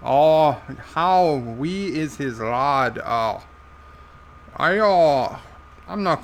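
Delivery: broad put-on Irish accent; Irish character voice. Joe echoing the viewer's question, thick Irish accent. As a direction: repeat it slowly as an Irish character. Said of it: "you're making me speak with an Irish accent".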